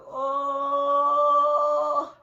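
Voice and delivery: very long, loud held "oh"; sustained reenacted wail of pain; pained moan. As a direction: groan loudly, acting out labor pain. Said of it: "It was so much pain".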